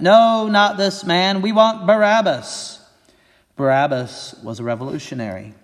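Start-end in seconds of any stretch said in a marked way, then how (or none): 0.0-2.8 loud, high and slow crowd shout. Acted. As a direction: bellow it back as the mob. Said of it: "They shouted back".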